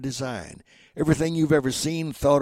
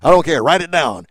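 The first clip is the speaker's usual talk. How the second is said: loud, emphatic order; hammed-up loud command; boss's demand; 4 semitones higher, 12 dB louder than usual. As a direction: insist like a CEO. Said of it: "All these C E Os say".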